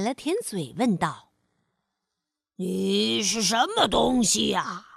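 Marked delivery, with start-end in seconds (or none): 2.6-4.9 low lion voice, curious; low growly lion asking. Acted. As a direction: ask like a big lion. Lion questions chameleon.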